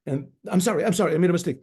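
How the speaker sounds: usual speaking voice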